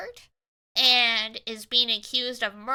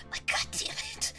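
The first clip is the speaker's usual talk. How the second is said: whispered curse; 7 dB quieter than usual. Real, not acted. Frustrated aside after laugh.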